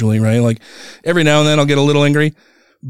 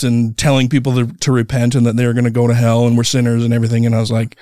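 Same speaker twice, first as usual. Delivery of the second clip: usual speaking voice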